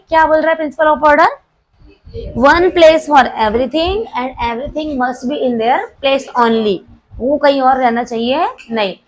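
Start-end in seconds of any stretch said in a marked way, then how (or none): 2.4-6.8 very slow, word by word, for notes; slow dictation. Shown as a demonstration. Teacher dictating to the class, slow word by word. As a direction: spell it out slowly so the class can write. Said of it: "teacher dictating principle again".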